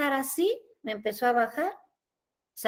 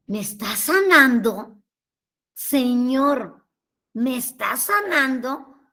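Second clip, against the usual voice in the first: slow, drawn-out disbelieving question; acted astonishment; slower than usual. Woman asking the Lord at mass if she is being healed, astonished. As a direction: ask the Lord in stretched-out wonder. Acts out amazed prayer during mass.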